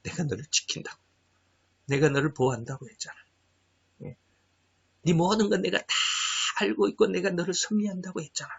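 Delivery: a hint of God's quiet reassurance; lightly voiced, hushed comforting God; God speaking softly. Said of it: "이 말씀 무지무지 많이 주셔요". (하나님이 조용히 안심시키듯 말하는 목소리)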